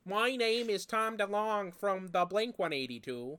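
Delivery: mock Californian rocker voice. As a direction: do him as a California pop-punk singer. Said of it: "He's like".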